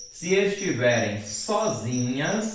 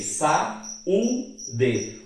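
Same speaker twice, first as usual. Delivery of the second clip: sa, u, de said as separate syllables with pauses between them; slow syllable-by-syllable demo of sa, u, de; split into syllables; 2 semitones higher, much slower than usual. Shown as a demonstration. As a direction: spell out sa, u, de syllable by syllable. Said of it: "O u está sozinho".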